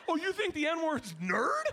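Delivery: high, slow, delighted disbelief; amazed realization. Retold or imitated. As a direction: stretch it out as the misunderstanding dawns. Relieved parents realize the misunderstanding.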